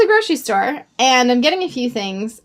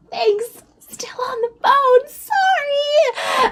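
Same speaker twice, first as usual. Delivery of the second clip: much higher, hushed apology; hurried whisper; 12 semitones higher, much slower than usual. Retold or imitated. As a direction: whisper the brush-off and slip away. Brushing stranger off while on phone.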